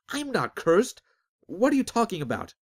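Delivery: high, loud desperate denial; protesting shout. Acted. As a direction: shout him down in disbelief. Azric protests, outraged.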